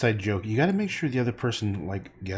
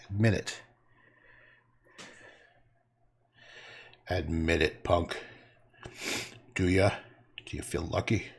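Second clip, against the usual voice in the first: tough guy taunt; 6 semitones lower than usual. Pretend. Taunting Wesley, Dirty Harry line.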